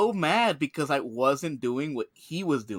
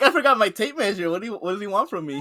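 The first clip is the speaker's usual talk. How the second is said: exasperated; 6 semitones higher, 5 dB louder than usual. Retold or imitated. Boss voiced, ranting.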